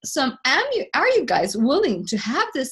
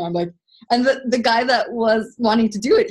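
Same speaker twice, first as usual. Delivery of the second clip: usual speaking voice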